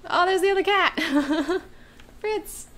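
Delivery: high, loud delighted squeal; light up as the cat appears; excited. Real, not acted. Second cat suddenly appears.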